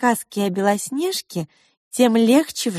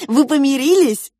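eager question; 5 semitones higher, 6 dB louder than usual. Acted. Girl asking parents eagerly.

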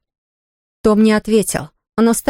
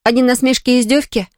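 plain reading voice

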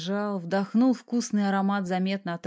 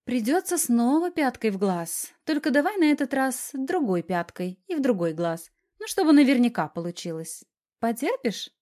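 higher, put-on sympathetic voice; mock sympathy; 5 semitones higher, 4 dB louder than usual. A narrator's reading. Wife teasingly warns husband of a heel, mock-sympathetic. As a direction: feign pity, pitched up. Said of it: "выдохнула с притворным сочувствием".

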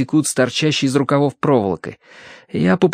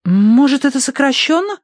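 put-on very high, questioning girl's voice; Tossie's voice; 14 semitones higher than usual. A narrator's reading. Tossie guesses at an abbreviation, high questioning voice. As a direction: voice Tossie much higher.

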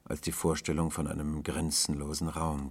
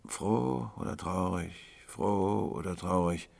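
slow, drawn-out pondering; brooding refrain; 2 semitones higher, slower than usual. A narrator's reading. Tramp turning one question over as he walks, slow brooding. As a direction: chant it slowly in step with walking. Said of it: "kreisten im Takt seiner Schritte".

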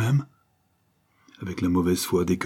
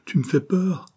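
much higher, fearful plea; frightened cry; 10 semitones higher than usual. A narrator's reading. Mother tells her furious son he scares her, afraid. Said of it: "cria-t-elle".

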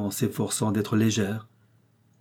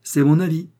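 firm declaration; 7 semitones higher, 6 dB louder than usual. A narrator's reading. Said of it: "déclara une voix masculine".

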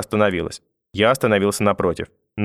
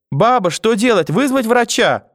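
put-on high, anxious little-boy voice; panicked child; 13 semitones higher, 4 dB louder, slower than usual. A narrator's reading. Grandson over collapsed grandmother, frightened and pleading. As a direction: plead urgently, pitched way up.

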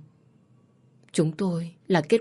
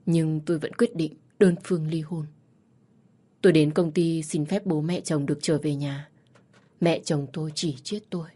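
ordinary delivery

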